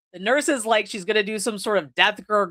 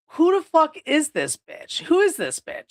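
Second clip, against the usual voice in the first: baffled outburst; 8 semitones higher than usual. Retold or imitated. Friends baffled by the recording.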